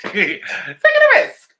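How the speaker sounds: high laughing repeat; laughing squeal. Real, not acted. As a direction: stretch it out slowly while cracking up.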